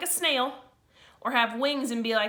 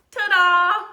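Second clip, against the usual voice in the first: higher, much louder rising ta-da; big reveal ta-da; 8 semitones higher, 12 dB louder than usual. Acted. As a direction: show it off like a magic trick. Reveals underwear wings.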